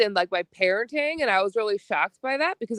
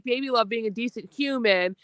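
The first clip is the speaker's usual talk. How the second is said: ordinary talk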